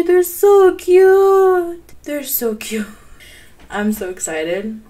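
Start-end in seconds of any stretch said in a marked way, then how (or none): 0.0-1.8 high, loud, slow gushing; drawn-out squeal. Real, not acted. Gamer cooing at a baby goat, slow high squeal. 2.0-2.9 breathy whisper of delight; whispered adoration. Real, not acted. Gamer whispering over the baby goat, soft and adoring. Same words whispered after.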